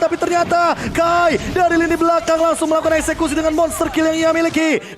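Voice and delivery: excited play-by-play. Real, not acted. Caster calls a monster kill.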